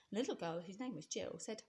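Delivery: plain reading voice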